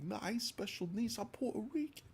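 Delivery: put-on Puerto Rican accent like a rapper; thick Puerto Rican accent. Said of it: "this really thick accent".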